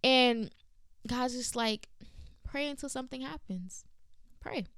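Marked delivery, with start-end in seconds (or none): 2.4-4.7 hushed voice of God; soft divine answer. Retold or imitated. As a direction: softly voice God's simple reply. Voicing God's gentle answer.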